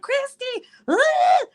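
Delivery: high, squealing greeting; mock shriek of joy at a friend arriving; excited greeting. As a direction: squeal her name with delight. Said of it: "I'm like".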